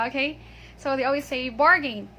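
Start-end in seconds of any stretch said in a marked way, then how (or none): 1.5-2.1 "bargain" with a full "gain" ending; mispronounced bargain. Retold or imitated. Teacher quotes how people always say it, bargain mispronounced. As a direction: say "bargain" wrongly as "BAR-gayn". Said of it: "but this is not bargain".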